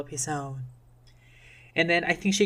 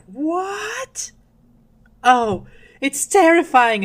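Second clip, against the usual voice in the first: high-pitched theatrical horror, drawn out; horrified mother; 10 semitones higher, 7 dB louder, much slower than usual. Acted. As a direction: play a queen appalled by her newborn. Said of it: "Esther is like".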